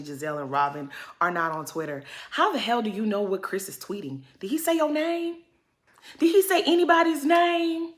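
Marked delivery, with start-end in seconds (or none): 4.4-7.9 incredulous challenge. Real, not acted. Challenges cast members mockingly.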